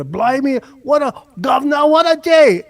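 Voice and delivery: high, loud hammed-up English accent; mock English accent. American showing how English people do it better, mock English accent. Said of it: "English people do it better".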